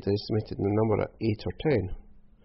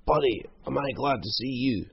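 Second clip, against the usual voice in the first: higher-pitched whine; whining; 4 semitones higher than usual. A narrator's reading. Bloodied Croc collapsing at Hal's feet, whining. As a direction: whine it like a desperate hanger-on. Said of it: "he whined".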